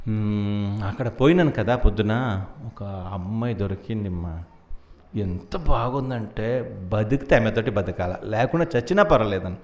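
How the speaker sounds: low, soft, hesitant admission; acted lovelorn confession. Son confessing to his mother he found a girl, low and lovelorn. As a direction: play a smitten son opening up quietly.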